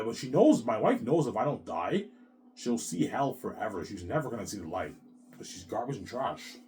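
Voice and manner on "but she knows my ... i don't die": usual speaking voice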